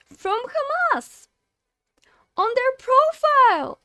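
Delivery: loud, high outraged rant; angry rant. Real, not acted. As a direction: tear into Twitch, voice up high and loud. Ranting about Twitch inaction.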